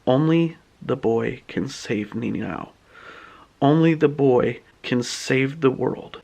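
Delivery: low, soft and slow, like a distant plea; ominous message. Acted. A captured hero's message calls for the boy, low and slow. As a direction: read it like a haunting summons. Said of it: "a little cloud pops up. And it reads".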